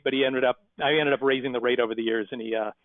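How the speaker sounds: ordinary talk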